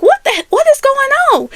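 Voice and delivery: high, startled what the; sudden alarm at the scene; shocked disbelief. Retold or imitated. Shocked at cars everywhere.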